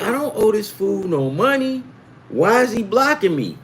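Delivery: high mock-indignant question; baffled retold protest. Retelling his baffled thought.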